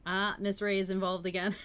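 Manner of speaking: usual speaking voice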